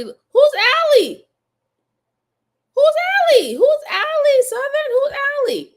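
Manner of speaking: high, loud, outraged questioning; indignant who's Allie. Real, not acted. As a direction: demand to know who Allie is. Indignant at viewer's comparison.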